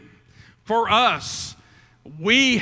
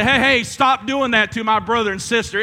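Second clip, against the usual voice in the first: louder and higher, calling out from the window; mock shout; 4 semitones higher, 7 dB louder than usual. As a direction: yell it at an unseen attacker. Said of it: "turn the light on and say".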